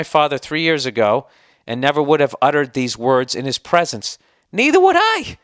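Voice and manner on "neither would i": startled pitch jump in a heated reply; voice cracking high. Real, not acted. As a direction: voice jumps way up high, a bit louder. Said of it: "Why did my voice go up so high?".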